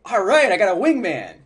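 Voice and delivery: excited. Shown as a demonstration. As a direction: cheer like you just found a wingman. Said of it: "like you're excited".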